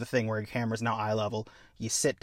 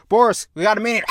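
calling out; 8 semitones higher, 8 dB louder than usual. Acted. Captain calling to crewmate.

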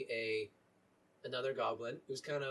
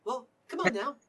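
put-on high, hopeful boy voice; pleading young cleric voice; eager young acolyte; 10 semitones higher, 5 dB louder than usual. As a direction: pitch it up like a keen kid asking to tag along. GM voicing Dylan again.

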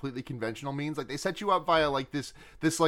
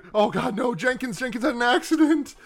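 raised, louder mock panic; distraught; 8 semitones higher, 6 dB louder than usual. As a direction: act out a distraught foreman crying out. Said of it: "he seems genuinely distraught".

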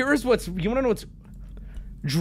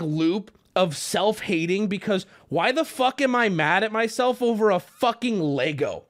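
usual speaking voice

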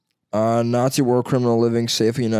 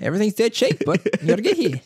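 mock Aussie; 9 semitones higher, faster than usual. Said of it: "They're like".

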